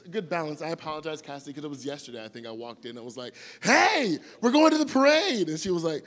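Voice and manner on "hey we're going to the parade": high, loud cheerful call; imitating himself bursting in to wake his wife for the parade; excited announcement. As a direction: burst in full of energy.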